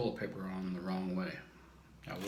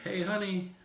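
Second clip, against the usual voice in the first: high-pitched imitation of wife's call; mock calling out; 11 semitones higher, 3 dB louder, slower than usual. Husband mimics wife yelling for him, mock calling out. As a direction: holler for your husband in a high voice. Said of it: "you yell for me".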